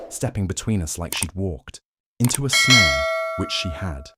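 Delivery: plain reading voice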